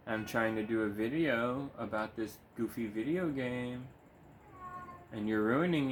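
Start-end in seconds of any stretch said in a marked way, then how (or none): none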